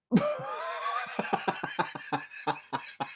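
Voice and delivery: long high cackling laugh; high cackle. Real, not acted. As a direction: cackle at your own joke. Laughs at own joke.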